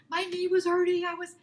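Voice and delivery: high-pitched mock excuse; excuse mode. Employee making excuses to her boss, high-pitched pleading. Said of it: "you go in your excuse mode".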